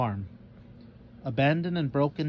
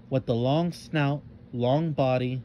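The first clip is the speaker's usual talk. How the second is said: ordinary delivery, nothing marked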